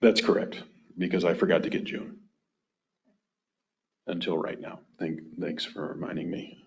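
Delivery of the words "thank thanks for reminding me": dry sarcastic thanks. Real, not acted. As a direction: thank them with a deadpan, ironic edge. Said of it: "And that's sarcasm on my part".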